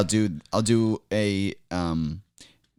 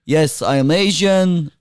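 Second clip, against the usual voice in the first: put-on Middle Eastern accent; 2 semitones higher than usual. Guest doing a requested Middle Eastern accent for the host. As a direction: play a pompous Middle Eastern dignitary.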